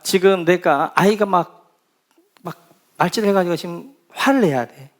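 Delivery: usual speaking voice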